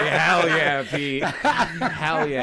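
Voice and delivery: loud, laughing hype for Pete; laughing cheer. Real, not acted. Hyping up Pete's boast, loud and laughing. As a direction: cheer him on through laughs.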